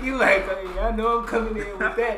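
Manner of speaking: loud, high, laughing. Real, not acted. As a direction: tease him while cracking up. Laughing, teasing friend.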